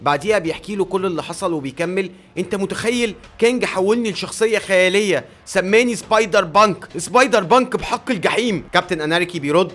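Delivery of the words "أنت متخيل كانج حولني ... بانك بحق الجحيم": higher-pitched outraged venting; indignant. Acted. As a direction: vent in disbelief to an ally, voice raised in pitch. Hero complains indignantly to friend. (باستنكار وانفعال)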